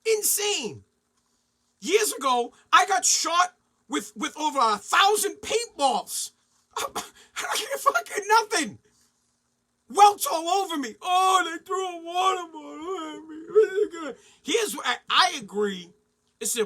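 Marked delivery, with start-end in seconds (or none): 0.0-0.8 high exasperated echo. Real, not acted. Comic fed up with thrown objects, high exasperated echo. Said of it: "It absolutely drives me insane". 6.8-14.1 high laughing whine of a crybaby; mock whining. Mocking a player who complains about a bottle, laughing whine.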